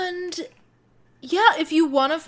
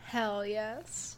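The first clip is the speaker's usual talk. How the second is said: whispered agreement; 3 semitones lower, 10 dB quieter than usual. Real, not acted. Echoing whispered excitement.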